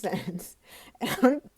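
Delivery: words breaking up into giggles; real laughing. Real, not acted. Reader caught by giggles mid-reading, laughing. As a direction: laugh helplessly through the words. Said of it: "I don't know why I'm laughing".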